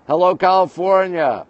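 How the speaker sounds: normal talking voice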